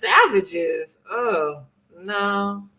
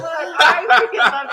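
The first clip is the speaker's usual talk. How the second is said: laughing; 8 semitones higher, 7 dB louder than usual. Real, not acted. Laughing delight.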